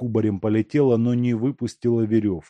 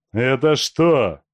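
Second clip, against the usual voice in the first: slow, louder exclamation; exclaiming; 5 semitones higher, 6 dB louder, slower than usual. A narrator's reading. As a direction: draw it out in loud surprise. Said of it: "воскликнул Федька". (медленное громкое восклицание)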